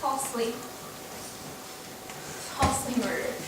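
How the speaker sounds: faint, dying murmur; dying whisper. Acted. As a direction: barely breathe it out. Dying Desdemona.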